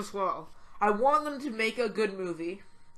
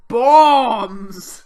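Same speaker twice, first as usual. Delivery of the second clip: sudden loud mock blast of a word; mock shout; 3 semitones higher, 16 dB louder than usual. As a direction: bark it out like a cartoon villain. Mocking Bane's bomb villainy.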